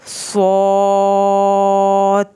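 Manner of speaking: "صاد" with a full heavy s and very long held vowel; three-alif madd. Shown as a demonstration. Teacher showing the exact madd length, elongated "صاد". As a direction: sustain "صاد" on one level tone. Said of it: "اس کو تین الف جتنا لمبا کرنا ہے".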